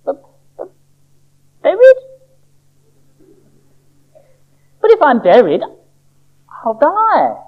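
high, loud panicked little seed; horrified. Acted. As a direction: shriek buried in horror. Sammy horrified at being buried.

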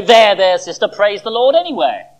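hammed-up jolly consolation; mock hearty comfort. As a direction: play the glib, hearty comforter. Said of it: "slap Mary heartily between the shoulder blades".

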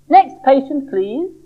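a hint of a brisk doctor dismissing him. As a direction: call the next patient in. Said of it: "said the doctor".

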